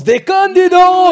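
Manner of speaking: sudden loud high shout. Real, not acted. Host shouting a joke after the dry reading. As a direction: burst out mockingly.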